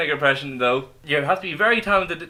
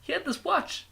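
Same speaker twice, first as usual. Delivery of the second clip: higher, halting Walken voice breaking into laughter; Christopher Walken impression; 9 semitones higher than usual. Host doing Walken's Pulp Fiction watch speech, laughing. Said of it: "the Christopher Walken impression".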